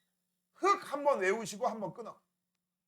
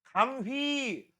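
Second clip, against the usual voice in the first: mock slow reading; 3 semitones higher, much slower than usual. Mocking student's slow misreading.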